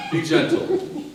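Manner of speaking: giggling "be gentle"; laughing. Real, not acted. Laughing through the line.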